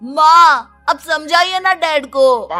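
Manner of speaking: high, loud call to mother; exasperated plea, pitched high; whining appeal. Acted. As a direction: complain loudly for her backup.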